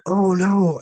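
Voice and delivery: retold panicky realisation; mock dismay. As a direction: act out horrified embarrassment. Retelling his dismay at mishap.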